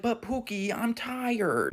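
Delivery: high, soft mock woman's voice; whining mom. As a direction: imitate a mother pleading to be babied. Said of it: "The woman was like".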